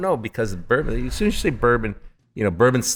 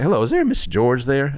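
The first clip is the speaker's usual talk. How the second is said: lightly put-on Southern accent, a little higher; Southern woman's accent; 6 semitones higher, 3 dB louder than usual. Government agent phoning the distiller, mild mock Southern accent. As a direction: imitate a Southern woman on the phone. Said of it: "But from the accent".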